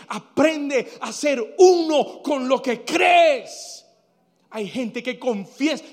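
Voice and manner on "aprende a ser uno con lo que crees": slow, higher, loud command, lightly voiced; the Lord's admonition. The Lord telling a believer to be one with belief, emphatic admonition. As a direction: press each word as a divine instruction. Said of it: "El Señor te va a decir".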